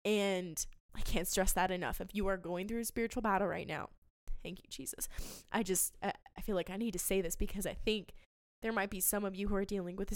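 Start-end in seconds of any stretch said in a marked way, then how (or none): 4.4-5.1 soft whispered thanks; whispered prayer. Real, not acted. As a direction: breathe it under your breath.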